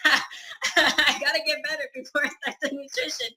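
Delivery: giggling through the excuse; high and laughing, sheepish. Real, not acted. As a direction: laugh while admitting the excuse. Retelling her excuse while laughing.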